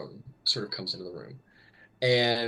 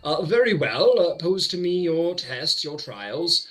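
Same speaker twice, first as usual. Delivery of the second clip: condescending mage, swelling louder and higher; self-important archmage; 4 semitones higher, 3 dB louder than usual. Acted. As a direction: grandly invite the challenge with a big ego.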